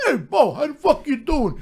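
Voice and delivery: high, put-on buddy voice; imitating a movie star's hearty greeting; Sly impression. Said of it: "He goes".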